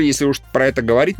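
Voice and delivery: normal talking voice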